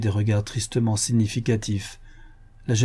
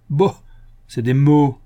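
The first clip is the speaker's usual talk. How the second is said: louder, higher dismissive scoff; distrustful and gruff; 4 semitones higher, 6 dB louder than usual. A narrator's reading. Invalid dismissing the young man's promise, distrustful and gruff. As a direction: brush it off gruffly. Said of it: "d'un ton méfiant et bourru".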